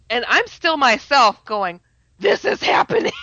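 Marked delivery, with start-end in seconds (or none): 2.2-3.1 awed exclamation. Retold or imitated. Overwhelmed meeting Jesus.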